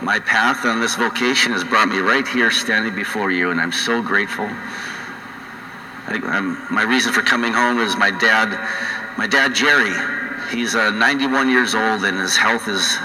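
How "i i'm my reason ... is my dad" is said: usual speaking voice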